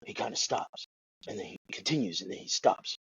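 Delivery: low and soft, pausing after "stops" and "continues"; halting, stop-start pauses. Retold or imitated. Podcaster mimicking an actor's American accent, halting and low. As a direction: break it up after each "stops", imitating an actor. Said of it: "when he speaks an American accent".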